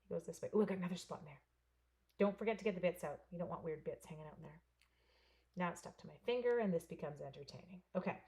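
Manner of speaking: ordinary talk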